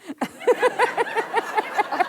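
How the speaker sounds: high, long laugh of the boss. Retold or imitated. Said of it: "Moise Rebeska's going".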